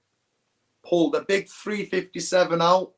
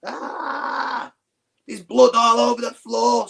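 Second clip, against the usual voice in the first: high shriek that gets louder on the blood; woman finding blood on the floor, high panicked scream; retold scream; 8 semitones higher, 4 dB louder, slower than usual. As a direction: imitate a woman's shocked scream in the toilets. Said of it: "I just hear this woman scream".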